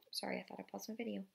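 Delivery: quick unvoiced aside; real whisper. Real, not acted. Reader apologizes to listeners for the video, quick whisper. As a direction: whisper an apology fast, off the page.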